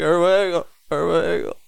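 very high, loud blubbering; hammed-up crying voice; imitated tearful wail. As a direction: mimic losing it on the phone, words smeared into a wail. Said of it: "trying so hard not t-, to not cry".

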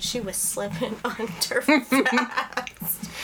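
slow whisper through laughter; laughing whisper. Real, not acted. Laughing whisper about the song.

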